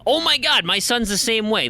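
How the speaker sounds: high-pitched burst of relating; excited recognition. Retold or imitated. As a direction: exclaim it, pitch leaping up. Retells excited recognition on hearing comment.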